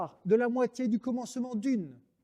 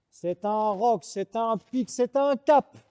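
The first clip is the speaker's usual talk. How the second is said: each noun louder and higher; building declamation; 4 semitones higher, 5 dB louder, slower than usual. Acted. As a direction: build from roc to cap, rising.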